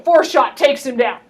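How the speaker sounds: ordinary talk, nothing marked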